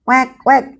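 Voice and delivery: put-on high playful quack for kids; high duck quack, playful. Shown as a demonstration. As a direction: quack like a duck, bright and bouncy. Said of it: "Bagaimana suara bebek?".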